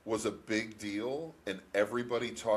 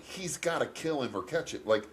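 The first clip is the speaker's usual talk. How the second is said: usual speaking voice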